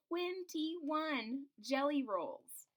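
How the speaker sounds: very slow, stretched twenty-one jelly rolls; drawn out. A narrator's reading. Reading aloud to children, slow dramatic emphasis. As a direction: savor each word for the kids.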